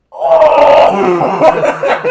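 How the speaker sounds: howling laugh; loud laughter. Real, not acted. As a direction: burst out laughing loudly.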